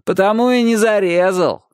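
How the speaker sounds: high, jeering punchline; schoolboy ridicule; mocking. A narrator's reading. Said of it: "насмешливо сказал один из Рыжиков".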